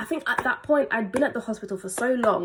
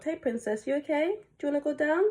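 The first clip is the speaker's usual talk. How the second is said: soft, high voice for a little one; sweet, softened parent voice; 6 semitones higher, 4 dB quieter than usual. Real, not acted. Aside to a small child.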